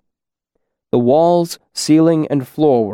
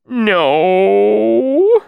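much higher, louder, hopeless cry; despairing cry; 10 semitones higher, 4 dB louder than usual. Acted. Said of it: "despairing cry".

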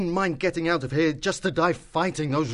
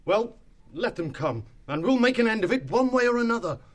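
rally the others for a last fight; resolute; 10 semitones higher than usual. A narrator's reading.

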